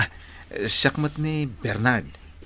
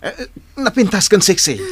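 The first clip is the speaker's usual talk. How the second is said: laughing; 7 semitones higher, 9 dB louder than usual. Acted. Flirty teasing, laughing.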